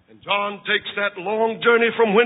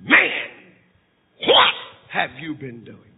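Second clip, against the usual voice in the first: priest's stern rebuke; 2 semitones higher than usual. Acted. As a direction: hammer every accusing "You". Priest confronts leprous John.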